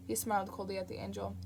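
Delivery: ordinary delivery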